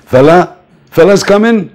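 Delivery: can't believe the star is coming; thrilled disbelief. Retold or imitated. Roadblock soldiers hearing [name] is coming, high thrilled questions. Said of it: "soldiers thrilled at the news".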